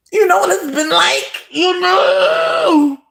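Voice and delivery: high, loud wailing cry; high loud mock sob; mock anguished cry. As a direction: wail it as if overcome. Overwhelmed by shrimp love.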